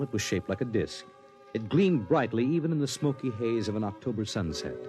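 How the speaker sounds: ordinary talk, nothing marked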